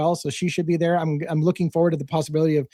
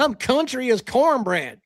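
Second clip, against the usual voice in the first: put-on country accent; 6 semitones higher, slower than usual. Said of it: "That's, that's a phrase right there".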